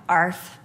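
low, deadpan "arf"; a spoken bark with no effort; unenthusiastic dog bark. Retold or imitated. Bored Chihuahua reacting to someone at the door, deadpan. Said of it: "looked at me and went".

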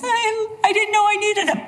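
high, whiny excuse to a judge; mock sheepish stammer; hapless litigant. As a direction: plead ignorance in a put-on high voice. Acted hapless litigant before the judge.

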